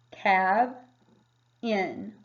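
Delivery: "cab, in" split into two syllables with a short A in cab; chunked cab in. Shown as a demonstration. Teacher showing the correct short-A syllable break. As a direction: break the word apart as "cab" then "in". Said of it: "Here you've got".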